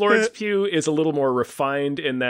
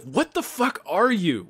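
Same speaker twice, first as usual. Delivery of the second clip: higher, slow mock bewilderment; baffled disbelief; 6 semitones higher, slower than usual. Baffled viewer reacting to an actor's put-on interview voice, mock disbelief. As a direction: stretch it out in exaggerated disbelief.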